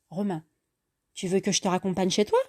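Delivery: ordinary delivery, nothing marked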